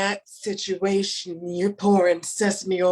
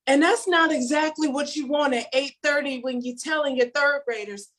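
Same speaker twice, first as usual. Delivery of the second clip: normal talking voice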